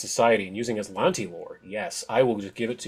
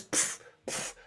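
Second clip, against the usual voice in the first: puffing machine noise; 12 dB quieter than usual. Acted.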